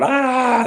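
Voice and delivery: high, loud mock yell of pain; retold scream of pain. Teen with a broken ankle on the field, retold pained scream. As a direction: yelp it out like you just broke your ankle. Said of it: "I'm freaking out".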